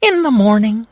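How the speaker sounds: high, excited bird voice; eager Hummingbird. Acted. Hummingbird planning to win the fish, high and eager. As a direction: dream of the fish.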